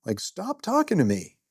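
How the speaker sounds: high pitched inner rebuke; scolding inner voice. Retold or imitated. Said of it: "I'm thinking".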